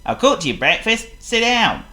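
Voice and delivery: loud, high, bright Clumsy hello; cheerful greeting. Acted. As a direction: sing out a happy good morning. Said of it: "said his cousin cheerfully".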